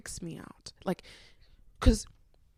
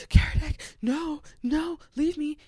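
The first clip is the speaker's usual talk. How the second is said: high, loud imitation of panicked pleading; mock-panicked cry, pitched way up; Morgan panicking; 10 semitones higher, 6 dB louder than usual. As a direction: act out a panic attack, pushing someone away. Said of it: "Morgan was having a panic attack".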